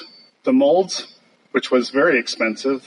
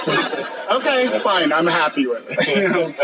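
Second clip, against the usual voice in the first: laughing; 4 semitones higher than usual. Retold or imitated. Retelling giving in, laughing.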